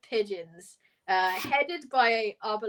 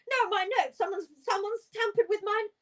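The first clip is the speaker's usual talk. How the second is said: high, panicked denial; flustered protest; 10 semitones higher, faster than usual. Acted. Flustered Richard insisting his notes were tampered with, high and panicky. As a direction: squeak out the excuse in a panic. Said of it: "he's just like".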